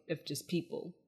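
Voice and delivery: ordinary delivery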